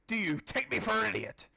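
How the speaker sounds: lightly voiced angry knight; mild indignant knight. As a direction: sneer at the fool.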